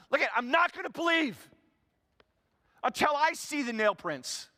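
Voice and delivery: high, loud and stubborn; defiant Thomas. Acted. Thomas refusing the disciples until he sees the wounds, loud. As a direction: set your terms and don't budge. Thomas stating his condition.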